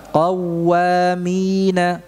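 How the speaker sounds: Qawwamina drawn out slowly with a thick qaf; slow recitation demo. Shown as a demonstration. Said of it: "Qaf, tebal".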